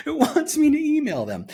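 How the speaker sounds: high incredulous laugh in the voice; high, amused disbelief. Real, not acted. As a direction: sound tickled and baffled.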